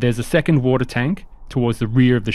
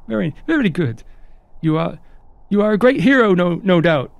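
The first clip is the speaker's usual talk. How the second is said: high-pitched, apologetic crew member; chirpy high host voice; eager, polite ship hand Onion; 6 semitones higher than usual. Acted. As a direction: play a courteous, high-voiced deckhand, lilting upward.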